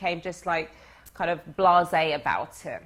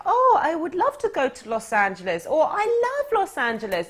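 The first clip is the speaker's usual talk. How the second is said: put-on high, loud, swooning girly gush; mock the eager women fawning over him; gushing girls; 8 semitones higher, 5 dB louder than usual. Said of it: "they turned around and be like".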